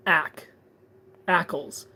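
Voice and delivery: Ackles as ACK-ulls, starting like "apple"; short A sound. Shown as a demonstration. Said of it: "You're not saying ah".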